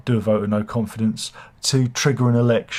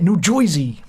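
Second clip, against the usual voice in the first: high, hammed-up New Jersey accent; 6 semitones higher, 3 dB louder than usual. Shown as a demonstration. Host showing his second accent after Somerset, mock New Jersey accent. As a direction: put on a cartoonish New Jersey voice. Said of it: "I did Somerset and New Jer".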